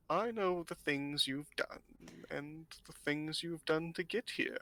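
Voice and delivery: put-on slow, ominous old seer, fading to a hush; knowing old fortune-teller. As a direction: pity him slowly, as if holding his secret.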